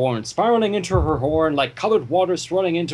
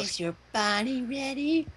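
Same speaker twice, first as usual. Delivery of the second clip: soft, higher teasing line; mock come-on; 6 semitones higher, 8 dB quieter than usual. Joking a suggestive line to friends, mock seductive. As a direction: tease it like a come-on.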